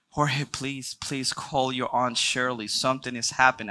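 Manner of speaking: normal talking voice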